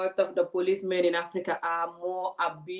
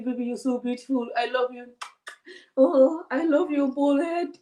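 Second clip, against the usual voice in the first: mild mock tender husband; lightly voiced tender husband; 6 semitones higher, 4 dB louder than usual. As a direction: coo like a loving husband. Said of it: "tell you".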